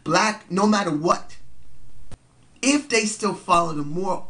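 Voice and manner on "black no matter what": usual speaking voice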